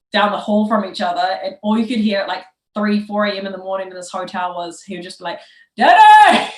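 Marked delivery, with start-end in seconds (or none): 5.8-6.5 high, loud drawn-out holler; yelled call. Retold or imitated. Imitating friend shouting down a hotel hall, yelled. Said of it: "like, yelling".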